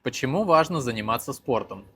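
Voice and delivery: ordinary talk, nothing marked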